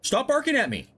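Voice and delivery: high, exasperated yell at the dogs. Real, not acted. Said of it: "Sorry about the yelling".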